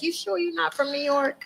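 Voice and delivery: lightly voiced curious stranger; a touch of a doubting visitor; imitating people. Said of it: "they'd be like".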